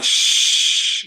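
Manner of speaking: long unvoiced hush; healing shh sound. Shown as a demonstration. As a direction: hush it out like calming a child. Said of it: "the healing sound for it is like".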